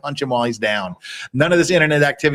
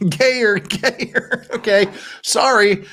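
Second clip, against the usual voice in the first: pitched up in a put-on camp voice; higher, camp voice; 6 semitones higher, much slower than usual. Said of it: "I need to be higher and gayer".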